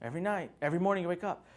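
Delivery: ordinary talk, nothing marked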